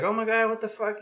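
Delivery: high-pitched imitation of a startled mother; alarmed mom. Mom being carried out in sheets.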